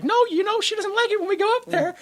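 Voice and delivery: high-pitched imitation of a mother; light, high voice playing mom; mock mom voice. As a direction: put on a high, fussy mom voice. Voices the mom in retold scene.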